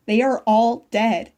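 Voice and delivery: anguished, heavy emphasis; slow, loud grief. A narrator's reading. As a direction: drag out each word, loud.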